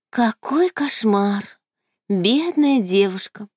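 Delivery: slow, high, hammed-up horror; mock horror. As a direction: feign horror, drawn out. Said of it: "в притворном ужасе".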